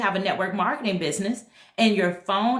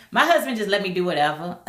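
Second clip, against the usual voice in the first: normal talking voice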